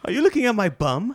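high, loud, laughing British voice; giggling through a hammed-up British line; mock British. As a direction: camp it up as a prim Brit.

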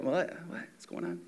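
very soft, groggy mumbling; just woken up. Retold or imitated. Preacher imitating anyone suddenly woken, groggy and confused. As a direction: imitate someone barely awake and confused. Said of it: "you wake up".

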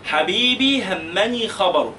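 slow, deliberate mnemonic line; slow dictation. Shown as a demonstration. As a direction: say it slowly so they can write it. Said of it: "اكتبوها". (إملاء بطيء للطلاب)

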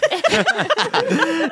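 shrill laughing rise; high squealing laugh. Real, not acted. Laughs at Southwest joke.

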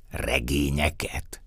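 ordinary delivery

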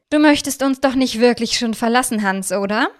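spöttisch und etwas lauter, von oben herab; verhöhnt ihn mit gespielter Enttäuschung. A narrator's reading. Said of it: "fragt Luise spöttisch". (Luise verspottet den festgenommenen Hans, spöttisch)

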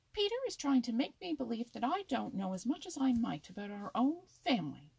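loud, high-pitched indignation; indignant snap. A narrator's reading. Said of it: "snapped Happy Jack indignantly".

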